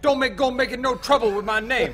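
high, loud mock comic voice; hammed-up, high-pitched warning; Chris Tucker. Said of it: "sound like Chris Tucker".

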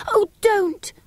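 high, crying plea; pleading cry. Acted. Girl begs brother not to dig, high crying plea. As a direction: beg him to stop, near tears.

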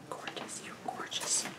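soft whispered compliment, barely voiced; hushed, admiring whisper; breathy whisper. Real, not acted. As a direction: breathe it out in awe. Whispered compliment to fan photo.

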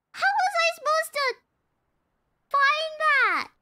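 high, loud, indignant complaint; exasperated protest. Real, not acted. Player protesting about the customers' order, exasperated.

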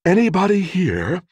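high woman calling in from outside; contralto woman's voice. A narrator's reading. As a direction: pitch it up high, a woman checking if anyone is in. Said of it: "A contralto voice from outside asked".

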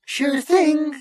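very high, chirpy machine voice; happy computer. A narrator's reading. Computer accepts the crew's order, happily. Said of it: "said the computer happily".

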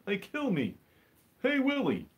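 high, slow alien puppet voice; squeaky sitcom alien; Alf impression. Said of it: "That's my Alf impression".

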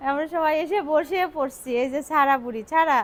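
loud, high bubbly chatter; energetic vlog excitement; excited. Real, not acted. Lively arrival narration.

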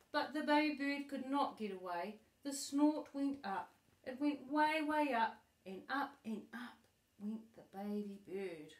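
animated read-aloud, bigger at each question; lively storybook reading. Acted. Grandma acting out the story for her granddaughter, lively storybook voice. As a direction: read it with growing excitement for the child.

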